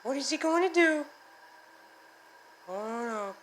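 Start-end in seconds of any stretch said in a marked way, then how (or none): none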